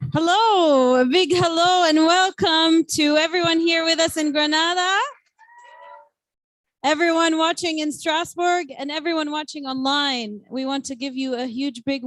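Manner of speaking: loud, high host welcome; announcer welcome. Acted. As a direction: open the show like a big announcer. Host opens the presentation loudly.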